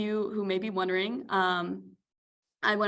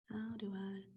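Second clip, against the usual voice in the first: whisper; 15 dB quieter than usual. Real, not acted. Fiddling with pointer, to herself.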